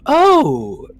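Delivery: high, drawn-out flirty greeting; coy queen voice. Acted. Fish queen flirting with the mage Victus. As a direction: purr his name slowly, flirting. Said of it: "she'll go".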